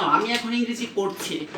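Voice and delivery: usual speaking voice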